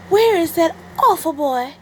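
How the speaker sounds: high, loud worried pig voice; Missus Pig screaming. Acted. Missus Pig runs to the wolf, high alarmed cry. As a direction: shriek out for the boy. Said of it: "screamed Missus Pig".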